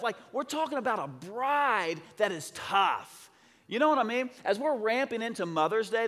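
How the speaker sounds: high, emphatic celebratory preaching; fired-up preacher's exclaiming; excited preaching. Real, not acted. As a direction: proclaim it to the crowd in amazement.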